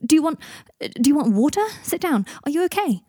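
high, loud rush of worried questions; Harry desperate to help Draco in agony; frantic. Acted. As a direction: fire off the questions in panic.

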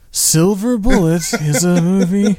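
slow, grumpy movie-trailer voice; mock irritated announcer. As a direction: imitate a bored annoyed announcer. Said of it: "You sound like".

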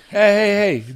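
loud, high "hey" to cut them off; loud protest. Real, not acted. Objecting to a joke about him.

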